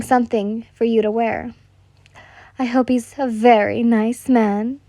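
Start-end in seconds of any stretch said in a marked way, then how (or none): none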